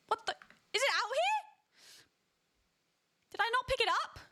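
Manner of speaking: high baffled searching; puzzled and rising alarm; confused panic. Real, not acted. Searching for lost bed.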